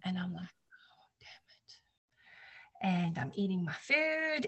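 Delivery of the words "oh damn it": hushed whisper under her breath; whispered curse. Retold or imitated. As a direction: whisper it so the hosts won't hear. Lights flicker at neighbors' dinner.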